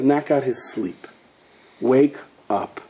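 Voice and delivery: usual speaking voice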